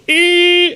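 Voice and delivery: a very high, sharp mock squeal. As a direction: squeak it out as high as you can. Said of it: "and goes".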